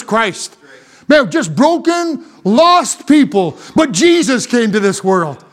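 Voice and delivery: impassioned pulpit delivery; forceful preaching. Real, not acted. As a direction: drive each phrase home to the congregation.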